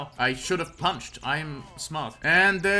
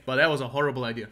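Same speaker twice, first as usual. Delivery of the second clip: ordinary delivery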